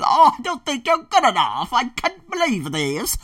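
lightly voiced scornful boss, dragging it out; mocking boss. Imitating the boss who threatened him, slow and sneering.

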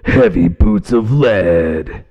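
slow, booming heavy metal roar; monster truck rally voice. Shown as a demonstration. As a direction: bellow it like a monster truck announcer. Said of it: "I sound like I'm doing a monster truck rally".

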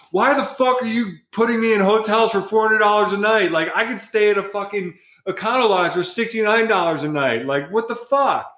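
high, indignant mock rant; retold outrage. Photographer ranting at his agent over hotel prices, high mock outrage. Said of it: "I'm like".

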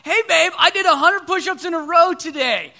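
bragging to his wife, high and loud; proud boast. Retold or imitated. Husband bragging to wife Sarah about push-ups, proud and loud. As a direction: show off the big news. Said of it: "I told Sarah".

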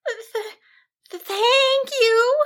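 high, halting sheep voice; timid little stammer, then drawn out. A narrator's reading. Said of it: "Cassandra stammered".

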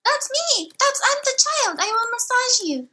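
put-on high, childlike robot voice; squeaky little android waking up; robot child voice. Robot child greeting the crew in a high voice.

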